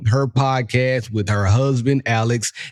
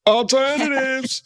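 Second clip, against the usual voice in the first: drawn-out high word; 12 semitones higher than usual. Real, not acted. Cutting in with the word.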